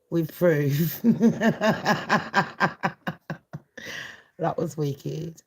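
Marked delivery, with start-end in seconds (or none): 1.0-4.1 a long, loose cackle of laughter. Real, not acted. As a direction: crack up at your own roast. Laughing at her own roast.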